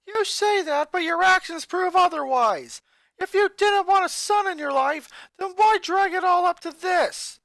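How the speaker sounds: high, loud bitter rant at his family; raging. Acted. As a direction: hurl every complaint in anger. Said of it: "his voice still held some rage".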